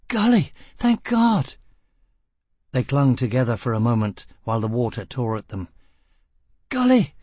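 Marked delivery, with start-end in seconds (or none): 0.1-1.6 relief. A narrator's reading. Gisbella relieved. 6.7-7.1 coughing out a word. A narrator's reading. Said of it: "Gisbella coughed".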